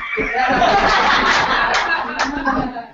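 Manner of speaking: long hearty laugh. Real, not acted. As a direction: laugh at the punchline. Laughs at her own story.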